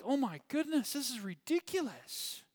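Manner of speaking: a mock eye-roll of disbelief; exasperated disbelief. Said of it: "And I'm like".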